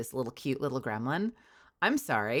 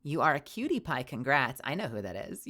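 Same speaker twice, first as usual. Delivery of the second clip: usual speaking voice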